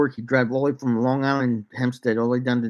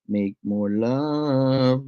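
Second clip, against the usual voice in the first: slow altered voice; much slower than usual. Acted.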